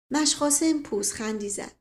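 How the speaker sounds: plain reading voice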